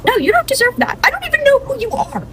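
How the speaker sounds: high-pitched, heated refusal; indignant outburst. Acted. Aspen rejecting a sister she never knew, indignant. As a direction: throw it back at them, voice way up. Acted quarrel with newfound sister.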